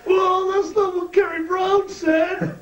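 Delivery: very high, slow, bewildered voice; high and slow, innocently confused; Carl's voice. Retold or imitated. Carl protesting to Bad News with a knife at his throat, very high.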